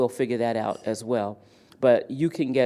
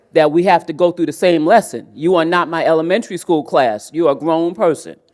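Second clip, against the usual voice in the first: louder, higher, emphatic telling-off; firm, punchy mock reprimand; exasperated scolding; 4 semitones higher, 8 dB louder than usual. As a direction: scold a grown adult who should know better. Mock scolding of slow adult learners.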